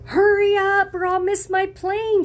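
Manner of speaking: high, loud frantic woman; woman in taxi pleading loudly; impatient passenger. Acted. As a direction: hurry the driver, panicked.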